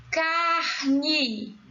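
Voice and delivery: "Carne" stretched over two long syllables; drawn-out syllables. Shown as a demonstration. Teacher showing the stressed syllable, "Carne" drawn out. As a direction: draw out "Carne" slowly. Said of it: "Qual é a sílaba tônica?".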